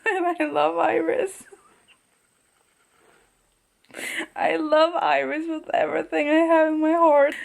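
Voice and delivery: high, gushing and overcome with love; getting emotional. Real, not acted. Reader moved by her love of Iris, emotional. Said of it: "I'm kind of getting emotional".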